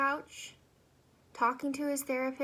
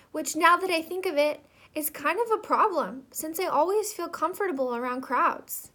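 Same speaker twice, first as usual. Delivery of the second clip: dramatic monologue, agitated and louder, stammering; Z, neurotic ant; 2 semitones higher, 5 dB louder than usual. Acted. Worker ant venting to his therapist, dramatic neurotic monologue. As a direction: stammer through your complaints, voice jumping up. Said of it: "a dramatic monologue of an ant named Z".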